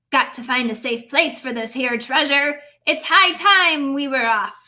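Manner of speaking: hearty captain's call; captain announcing. Acted. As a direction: announce it to the whole ship. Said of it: "he announced".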